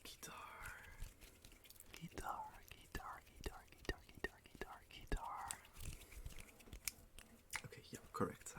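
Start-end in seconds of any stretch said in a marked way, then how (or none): 0.0-5.5 soft unvoiced whisper of each word. Shown as a demonstration. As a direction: breathe the word out with no voice. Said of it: "I was whispering".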